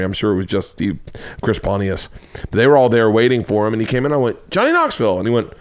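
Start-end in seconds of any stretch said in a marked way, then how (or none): 4.5-5.2 high-pitched greeting call; re-enacted thrilled hello; excited call out. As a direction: sing out a friend's name in delight. Acts out calling to arriving friend.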